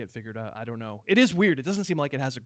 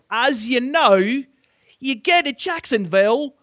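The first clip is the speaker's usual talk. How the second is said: slow, higher, exaggerated Aussie-tinged voice; put-on Aussie-ish accent; 9 semitones higher, 5 dB louder, much slower than usual. Host hamming up the London game, mock Aussie accent. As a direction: slide into a drawn-out mock Australian accent. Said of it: "it was a little bit Aussie there".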